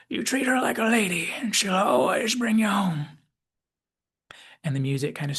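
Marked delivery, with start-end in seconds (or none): 0.1-3.2 put-on fond old Southerner giving parting advice; Southern McCoy. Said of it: "while McCoy says".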